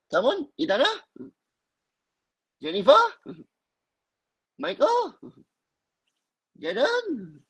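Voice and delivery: high, sing-song roll call; calling out names. Real, not acted. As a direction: call each name up high. Calling on students by name.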